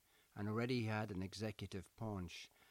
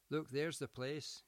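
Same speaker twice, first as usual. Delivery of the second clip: calls ahead, higher and louder; lightly voiced call from up the hill; 7 semitones higher, 4 dB louder than usual. A narrator's reading. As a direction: call back to her from a distance. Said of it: "he called".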